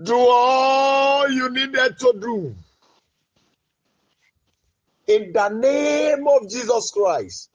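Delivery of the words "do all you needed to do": fervent shout. Real, not acted. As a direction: urge God on with full force. Urging God in prayer.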